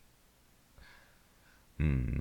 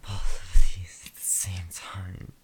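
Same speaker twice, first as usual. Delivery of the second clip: whispering; 11 dB louder than usual. Acted. Intimate whispered roleplay.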